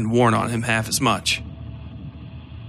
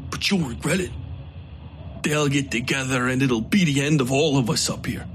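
warning outlaw; 2 semitones higher, 4 dB quieter than usual. Acted. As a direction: threaten him darkly. Coakley warns.

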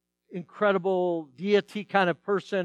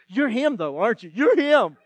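imitated girl, building louder and higher; insistent excited girl; 4 semitones higher, 6 dB louder than usual. Girl insists the substitute is the actor, excitedly. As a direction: press the point, rising to a loud finish. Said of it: "they're so excited".

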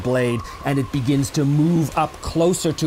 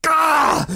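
high, loud gasp out of a nightmare; startled gasp waking; 12 semitones higher, 4 dB louder than usual. Acted. Edbert waking from a nightmare with a loud startled cry. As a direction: jolt awake with a cry. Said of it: "wakes with a start".

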